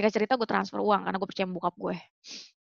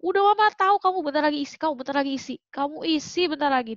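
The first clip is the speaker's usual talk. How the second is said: imitating mom; 6 semitones higher, faster than usual. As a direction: quote mom like she's sure of it, pitched up. Mother voiced predicting pregnancy.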